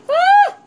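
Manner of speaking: sharp high yelp of pain. Real, not acted. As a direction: yelp as the steam burns. Burning thumb on steam.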